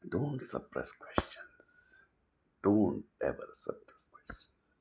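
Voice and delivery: hushed, drawn-out plea to the audience; slow urgent whisper. Real, not acted. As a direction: whisper it slowly, like a secret warning. Urgent advice, whispered slowly.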